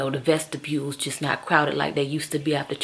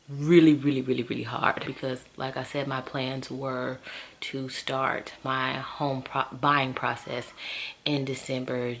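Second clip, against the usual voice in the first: quiet musing; 4 semitones lower, 8 dB quieter than usual. Real, not acted. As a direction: think aloud to yourself.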